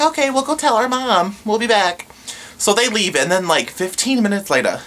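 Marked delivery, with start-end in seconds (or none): 0.0-2.1 put-on high, light kid voice; imitating the little girls, pitched up; mock child voice. Kids voiced in retold story.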